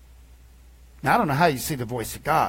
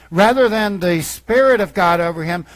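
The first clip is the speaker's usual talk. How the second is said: ordinary talk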